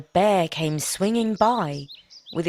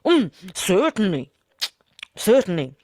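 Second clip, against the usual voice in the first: mouth full; 4 dB louder than usual. Acted. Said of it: "mouth crammed full".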